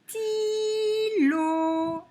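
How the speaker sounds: stylo stretched high as stiii-looo; high, drawn-out sty-lo; syllable by syllable. Shown as a demonstration. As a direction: hold both syllables of stylo. Teacher stretches the example word.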